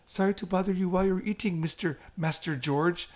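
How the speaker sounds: high, sweet maid announcement with laughter; imitating a maid. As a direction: call a customer up like a cafe maid, laughing. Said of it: "they're like".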